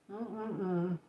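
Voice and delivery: talking with mouth full; words through a mouthful of food; chewing noisily. Real, not acted. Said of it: "sorry I'm eating with my mouth, talking with my mouth full".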